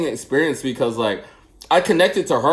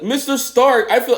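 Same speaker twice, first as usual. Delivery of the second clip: imitating Spider-Man; 8 semitones higher than usual. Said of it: "My son can do a perfect imitation".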